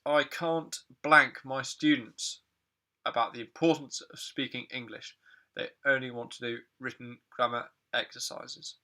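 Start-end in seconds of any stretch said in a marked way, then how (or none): 0.1-5.2 slow, louder, "blank" drawn out; slow quiz read. Shown as a demonstration. Teacher reading the gap sentence to students. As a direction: spell it out slowly for learners. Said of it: "reading quiz sentence slowly".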